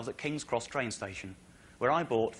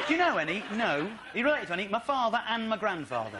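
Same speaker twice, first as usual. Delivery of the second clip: tag; 6 semitones higher than usual. Retold or imitated. Mock-outraged replay of the interview.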